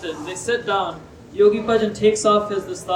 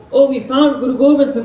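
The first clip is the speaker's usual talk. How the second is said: loud mock shouting, a little higher; retold scream; 4 semitones higher, 6 dB louder than usual. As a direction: call it out like soldiers who found their target. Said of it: "they start to scream".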